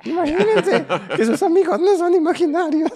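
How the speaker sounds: high, loud mock presenter through laughter; hammed-up children's host, cracking up; laughing kids' host. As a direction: joke to kids while laughing. Mock kids-show host, laughing.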